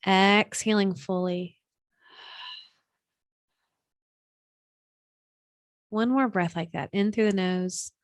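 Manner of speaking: unhurried, soothing breathwork guidance; guided meditation. Acted. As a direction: guide them gently into stillness, leaving room between phrases.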